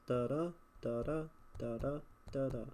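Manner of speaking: "Da da" chanted in even pairs, second beat stressed; metrical beat. Shown as a demonstration. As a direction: tap out the meter on "da da". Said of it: "That's your meter again".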